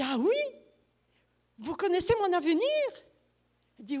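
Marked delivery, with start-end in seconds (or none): 0.0-2.6 mock surprise. Speaker teasing astrologer.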